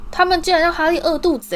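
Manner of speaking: high, loud outcry of protest; indignant protest. A narrator's reading. George protests to his mother about Harry's treatment, indignant. Son protests on friend's behalf.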